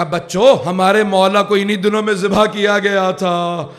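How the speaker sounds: loud, high lamenting wail, drawn out; crying mother. Acted. Weeping mother tells her children of the Imam's killing, wailing. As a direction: cry out the terrible news, stretching each phrase. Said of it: "آپ اتنا کیوں رو رہی ہیں".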